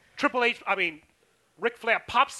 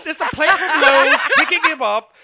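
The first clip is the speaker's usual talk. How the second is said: laughing; 6 semitones higher, 10 dB louder than usual. Real, not acted. Laughing at bad entrance.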